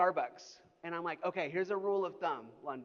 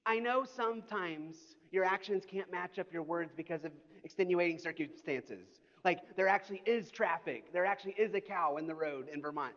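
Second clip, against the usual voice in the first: ordinary talk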